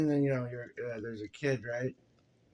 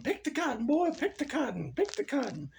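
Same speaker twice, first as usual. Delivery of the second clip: much higher mock overseer voice barking orders; mock slave driver; 14 semitones higher than usual. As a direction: ham up a cartoonish cotton-field boss, pitched way up.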